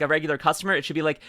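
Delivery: usual speaking voice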